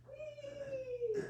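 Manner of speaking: high flying whee; gleeful whee. A narrator's reading. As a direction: let it soar like flying through the air. Animals flying through the air.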